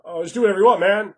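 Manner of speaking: confused GM. Retold or imitated. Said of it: "they're so confused".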